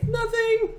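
put-on high, loud little kid's "Nothing"; a four-year-old playing innocent; squeaky childish denial. Four-year-old denying theft.

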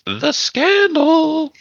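high, slow dramatic gasp of scandal. Acted.

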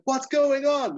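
high, loud, excited question; mock eager question. Rusty instantly invested in the adventure, high mock eagerness.

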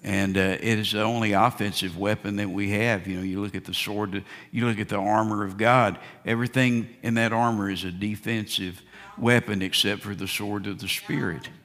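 ordinary delivery

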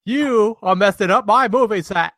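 a mock Cockney geezer telling off the crew; Cockney accent. A Cockney bloke scolding the crew on set, hammed-up Cockney accent. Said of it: "That was a little bit of London for you".

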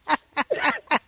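high, bright burst of laughter; delighted laugh. Real, not acted. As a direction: laugh out in happy surprise. Laughing at son's greeting.

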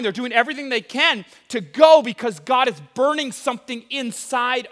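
loud, high-energy sermon; preaching. Acted. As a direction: preach it with fire. Preaching at full force.

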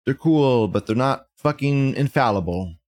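ordinary talk

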